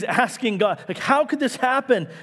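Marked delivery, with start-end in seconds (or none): 1.0-2.1 high, pleading how could this happen; an exasperated plea to God; exasperated cry. Acted. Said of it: "It's an exasperated cry".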